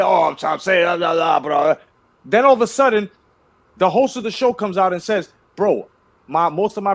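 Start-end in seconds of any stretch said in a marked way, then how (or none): 0.0-1.7 mock dismissive rant. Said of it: "he looked away, swatted in there".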